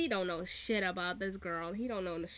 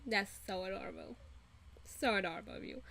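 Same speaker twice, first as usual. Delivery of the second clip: condescending cooing; 2 semitones lower, 6 dB quieter, faster than usual. Retold or imitated. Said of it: "I would be like".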